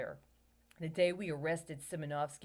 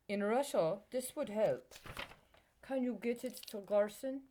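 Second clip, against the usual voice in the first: lightly put-on Russian accent; 3 semitones higher than usual. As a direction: read the quote with a weak Russian accent. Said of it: "My Russian accent's terrible".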